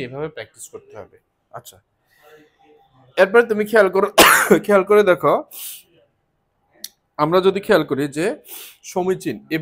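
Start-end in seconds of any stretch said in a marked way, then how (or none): 3.2-9.6 loud. Real, not acted. Teacher calls attention, suddenly loud.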